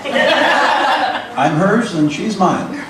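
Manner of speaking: drawn out slowly and fondly; husband says it slowly, teasing his wife; slow fond joke. Real, not acted. Husband introducing himself to the audience, slow and fond.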